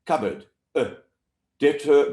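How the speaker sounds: usual speaking voice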